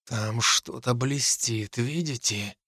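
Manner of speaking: slow, soft near-whisper; hushed, careful question. A narrator's reading. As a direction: whisper slowly so only companions hear. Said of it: "Я перешёл на шёпот".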